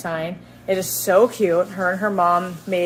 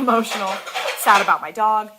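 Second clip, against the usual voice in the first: ordinary talk, nothing marked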